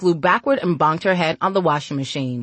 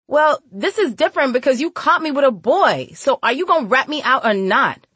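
put-on high, louder girlish voice; worried sassy girl; 8 semitones higher, 4 dB louder than usual. A narrator's reading. Girl asks whether woman will rat her out, high and insistent. Cutie pleads, caught with a boy.

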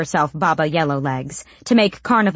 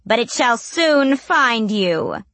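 slow, higher prophetic crone; a slow, higher doom-laden promise; ominous witch; 6 semitones higher, slower than usual. A narrator's reading. Witch warns as they leave.

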